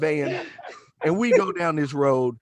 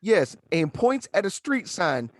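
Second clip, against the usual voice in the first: ordinary talk, nothing marked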